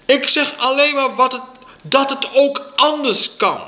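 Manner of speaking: high, loud protest; schreeuwende ruzie. A narrator's reading. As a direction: shout back in a heated quarrel. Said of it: "zo ging het geschreeuw maar door". (luid schreeuwend in verweer)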